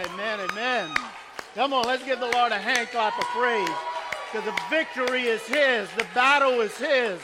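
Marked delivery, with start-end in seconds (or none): none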